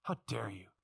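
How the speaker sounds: low, hushed reproach; restrained indignation. Acted. Preacher rebuking talk of killing a baby, quietly indignant. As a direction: drop the voice right down in indignation. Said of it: "You can be indignant".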